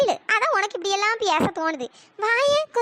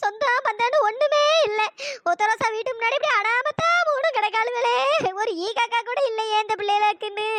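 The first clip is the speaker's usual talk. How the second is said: tearful dirge, calling on the dead; weeping lament. Acted. Mourner crying over friends abandoned in the street, weeping lament. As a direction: sob through it, trailing into wails. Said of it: "கிடந்து அழுதுட்டு கிடக்கவே".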